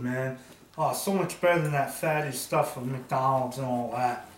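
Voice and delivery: loud, booming, greedy eater bragging over his food; a loud, hungry character gloating over a feast; loud glutton voice. Acted.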